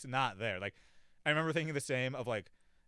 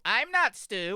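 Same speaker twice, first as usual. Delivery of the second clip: higher, louder mock mom outburst; 10 semitones higher, 9 dB louder, much slower than usual. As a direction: imitate a mom suddenly erupting. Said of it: "her mom does a total".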